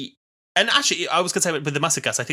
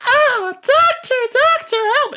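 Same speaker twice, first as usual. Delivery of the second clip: loud, high, slow wail; mock cry for help; 7 dB louder, slower than usual. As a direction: play a hammy damsel in peril. Acting companion crying for help.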